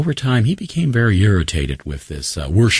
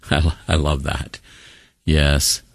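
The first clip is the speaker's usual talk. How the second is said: ordinary talk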